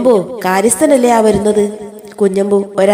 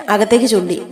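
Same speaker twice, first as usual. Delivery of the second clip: ordinary delivery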